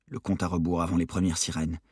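plain reading voice

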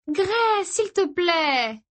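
put-on high, drawn-out girlish plea; insistent pleading. A narrator's reading. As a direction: beg him, stretching the words slowly. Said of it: "insista Izzy en attrapant son bras". (supplication aiguë et traînante)

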